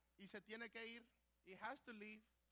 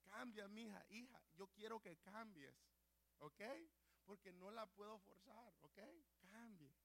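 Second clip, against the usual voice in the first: much softer, gentle pleading; tender plea; 4 semitones lower, 12 dB quieter than usual. Retold or imitated. God gently begging his children to change, softly. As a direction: coax softly like a parent. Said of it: "Te está diciendo".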